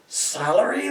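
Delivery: high shocked squeak on Salary?; shocked gasp. Acted. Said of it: "they gasped".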